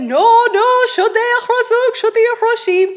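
high, loud imitation of the old man; old neighbour's voice. Old neighbour tells the visitor which crosses, high and loud. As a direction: play the neighbour brushing the question off.

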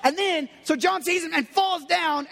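normal talking voice

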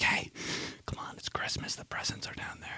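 very soft unvoiced coaxing; whispering a fake Christmas wake-up. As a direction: hush it into a sleeper's ear. Waking someone at night.

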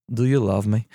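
lightly put-on Russian dialect; slight Russian accent. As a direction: slip into a mild Russian accent. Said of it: "I said I wasn't gonna do it".

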